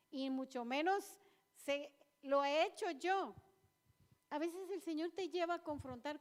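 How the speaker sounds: impassioned church exhortation; preaching at full force. Real, not acted. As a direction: drive each line home to the congregation.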